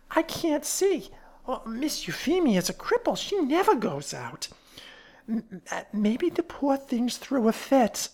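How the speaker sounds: high, swooping character voice; fat woman, bewildered. Acted. Fat woman guessing about the cripple upstairs, bewildered. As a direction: puzzle it out in a high, folksy voice.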